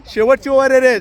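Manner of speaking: loud call to the players. Real, not acted. Calling out last over to players.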